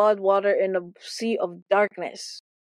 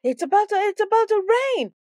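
raised, higher call of it's about to rain; 13 semitones higher, faster than usual. Shown as a demonstration. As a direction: say it again much higher as if they didn't hear.

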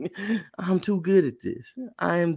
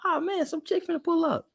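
eager. Retold or imitated. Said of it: "you thinking".

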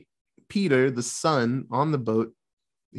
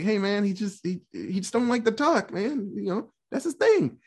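higher, pleading buddy voice; Tommy pleading; 7 semitones higher than usual. Retold or imitated. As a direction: talk them out of it, friend. Tommy defends silent Gibson.

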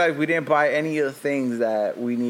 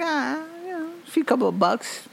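mock casual shrug; 13 semitones higher, 3 dB quieter than usual. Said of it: "You're like".

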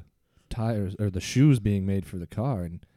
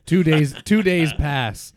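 dramatic time-jump announcement, higher and louder, slow; announcer; 6 semitones higher, 5 dB louder, slower than usual. A narrator's reading. Narrator announces the time jump like a dramatic trailer. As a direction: boom it out like a movie trailer.

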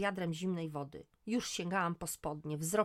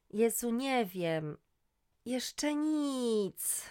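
slow, higher yawning reply; 6 semitones higher, 5 dB louder, much slower than usual. Acted. Sleepy woman answers friend, yawning. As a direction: yawn through the words. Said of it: "odparłam ziewając".

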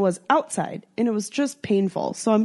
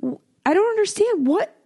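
baffled; 12 semitones higher than usual. Retold or imitated. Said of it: "And I've".

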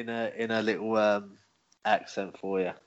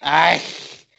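Ike voice; 4 semitones higher, 8 dB louder than usual. Shown as a demonstration. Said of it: "Do your Ike thing that you did".

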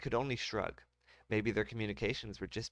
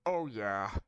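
colonel voice; 3 dB louder than usual. Acted. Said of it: "I'm sorry".

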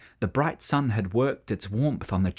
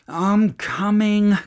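slow strained groan, higher; 6 semitones higher, 5 dB louder, slower than usual. A narrator's reading. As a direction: groan it out while stuck. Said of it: "groaned Sam".

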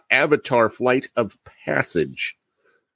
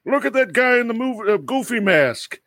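much higher, silly tourist voice; high goofy voice; 10 semitones higher than usual. Retold or imitated. A dad mimicking a goofy tourist at the Muppet show, voice pitched high. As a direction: squeal it like a gawking kid. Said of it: "And then someone".

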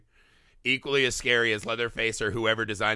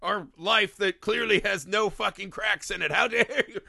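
hammed-up indignant rant; imitating angry hillbilly; 7 semitones higher than usual. Host voicing the offended Sawyer, higher and hammed-up. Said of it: "And he's like".

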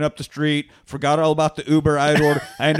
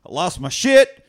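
louder, high, drawn-out comic exclamation; excited outburst; 6 semitones higher, 5 dB louder than usual. Retold or imitated. Guy retells wandering off after getting the part, excited outburst. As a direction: build to a stretched, incredulous shout.

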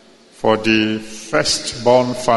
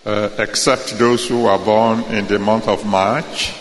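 rally speech. Acted. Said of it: "let me hear you shout hallelujah".

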